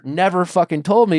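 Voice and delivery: normal talking voice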